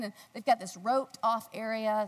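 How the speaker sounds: ordinary talk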